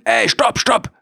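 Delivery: high urgent yell of stop; shouting stop. Retold or imitated. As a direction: call out sharply to halt the fight. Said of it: "ich rufe in dem Moment".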